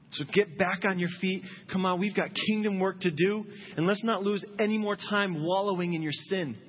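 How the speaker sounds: rally them like a coach; rousing voice of God. Retold or imitated. God urging the forgiven child back to work, rousing.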